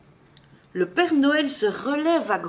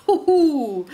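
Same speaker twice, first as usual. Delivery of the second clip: high, loud joyful whoop; gleeful storybook hoot; cry of joy; 5 semitones higher, 6 dB louder than usual. A narrator's reading. Santa tumbling over as the sleigh moves, joyful whoop. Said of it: "avec un grand cri de joie".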